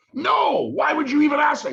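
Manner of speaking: high, loud offended protest; indignant shout. Retold or imitated. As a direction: yell it, offended.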